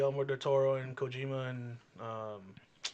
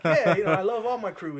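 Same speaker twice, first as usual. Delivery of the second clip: laughing; 6 semitones higher, 10 dB louder than usual. Retold or imitated. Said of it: "he's like".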